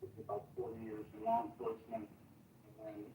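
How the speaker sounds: muffled, far from the microphone; distant, muddy mic sound; muddy boomy voice. Real, not acted. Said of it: "You sound a little muddy".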